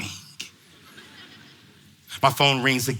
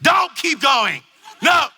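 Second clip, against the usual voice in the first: high, slow, raised plea; hammed-up inner shout; exasperated mock protest; silent protest voiced; 9 semitones higher, 4 dB louder than usual. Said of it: "in back of my head".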